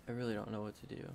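hushed, mock-despairing goodbye to viewers; fake sad. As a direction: pretend to be heartbroken and ready to quit. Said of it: "April Fools, everybody!".

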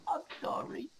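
ordinary talk, nothing marked

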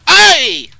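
very high, very loud startled yell; startled scream. Real, not acted. Player attacked while hunting the big squirrel, startled scream. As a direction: scream out as if suddenly hit.

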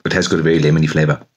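talking while chewing chili. Real, not acted. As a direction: speak around a mouthful. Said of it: "let me just chew on this one".

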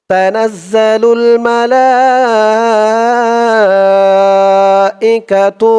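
slow melodic tilawat chant; Quran recitation. Shown as a demonstration. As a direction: draw out each word in recitation tune. (ধীর সুরেলা কুরআন তিলাওয়াত)

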